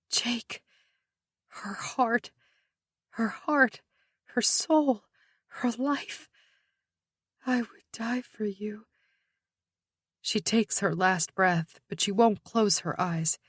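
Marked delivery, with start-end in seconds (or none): 0.1-8.9 soft, near-whispered farewell; hushed tenderness. A narrator's reading. Woman facing death, thinking of her loved ones, whispering. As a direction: let it sink almost to a whisper.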